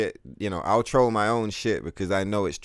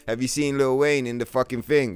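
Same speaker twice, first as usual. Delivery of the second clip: ordinary talk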